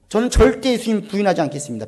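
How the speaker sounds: ordinary talk, nothing marked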